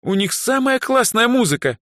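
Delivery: high, fervent fan's claim; excited high-pitched protest; indignant enthusiasm. A narrator's reading. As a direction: defend your favourite band passionately. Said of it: "возмутилась Даяна так сильно".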